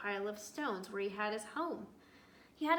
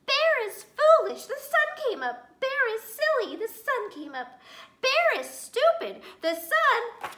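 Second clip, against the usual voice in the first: high, loud squeaky taunting; much higher, louder mocking sing-song; teasing; 14 semitones higher, 14 dB louder than usual. A narrator's reading. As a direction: jeer at the bear like a cheeky child. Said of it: "began to tease".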